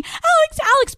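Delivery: very high, panicked mock wail; mock crying. Boyfriend standing over passed-out girlfriend, mock crying. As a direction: imitate someone crying out a name. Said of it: "above me crying".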